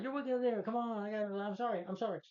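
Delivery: high-pitched mock excited fan voice; gushing fan. Bystanders apologizing to Spider-Man in a high mock-fan voice. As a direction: squeal it like an adoring bystander.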